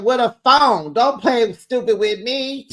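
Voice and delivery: high, loud sassy retort; mock sassy snap. Cookie snapping at Stacy, high loud mock sass. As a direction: snap back with attitude up high. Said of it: "Cookie said".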